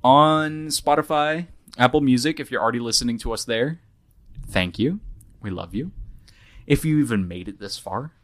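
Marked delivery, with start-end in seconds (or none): 0.0-2.4 announcer voice. Acted. Announcing podcast platforms.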